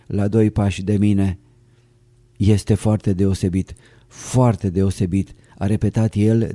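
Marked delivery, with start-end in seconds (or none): 2.4-5.3 slow, weighty insistence on foarte; a touch of slow, nodding emphasis; emphatic repetition. A narrator's reading. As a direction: lean slowly on each word to convince. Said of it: "dând din cap spre a-și întări spusele".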